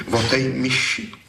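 high, deflated little echo; dejected. Acted. Thief dejected as his mouse poem is criticised. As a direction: repeat it, crestfallen. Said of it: "pravil hlas malomyslně".

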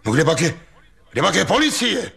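high, hurried demanding call; agitated high-pitched shout of demand. A narrator's reading. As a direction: call urgently over the crowd. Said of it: "volal do toho Ostrohradský".

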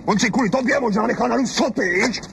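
high, taunting jab; last mocking shot; jeering retort. Husband mocks the wife and the bearded neighbour, jeering.

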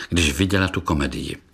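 plain reading voice

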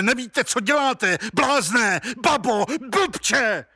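building from a slow warning to high, urgent cries; a dreadful voice calling someone back, pitch climbing to frantic insults; terrible voice. A narrator's reading. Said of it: "strašný hlas".

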